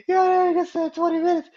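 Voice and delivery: very high, squeaky laughing voice. Real, not acted. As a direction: retell it through real laughter. Still laughing as he retells.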